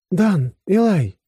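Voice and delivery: high, drawn-out call of names. A narrator's reading. Woman calling the men back at the exit, high call. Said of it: "окликнула меня Аста".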